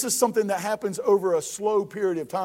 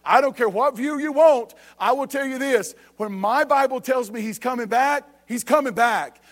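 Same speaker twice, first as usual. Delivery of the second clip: ordinary talk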